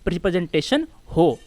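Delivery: ordinary delivery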